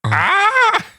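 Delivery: shrill falsetto movie scream; Wilhelm scream. Pretend. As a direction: scream like a stabbed extra. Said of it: "That needs to be in every podcast".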